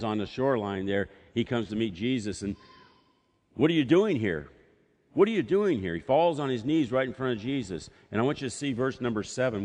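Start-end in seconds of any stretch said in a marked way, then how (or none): 3.5-5.9 higher, louder frantic cry; possessed man yelling; demoniac shouting. Acted. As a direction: shout it at the intruder. Demoniac confronting Jesus.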